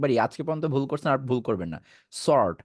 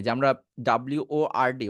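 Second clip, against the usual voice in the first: ordinary talk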